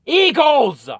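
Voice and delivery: sudden loud, high blurt of the name; frustrated blurt. Real, not acted. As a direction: blurt it out after fumbling the word. Finally finds the right team name.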